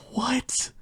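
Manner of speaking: very high, soft "What?"; tiny high-pitched disbelief; shocked squeak. Acted. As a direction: squeak it in alarm. Narrator reacts to mouse's threat.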